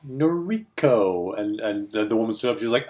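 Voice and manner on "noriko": slow questioning repeat of the name; drawn-out repeat. Retold or imitated. Repeating the name, waiting for reply.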